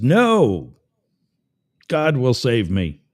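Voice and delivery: acted call back, loud then slowing firmly. Stranded man on his roof turning down a rescue, calling back.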